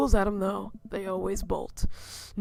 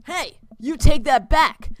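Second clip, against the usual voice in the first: loud, slow indignant shout; angry retort; 5 semitones higher, 10 dB louder than usual. Acted. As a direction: demand it back, offended. Defending the movie.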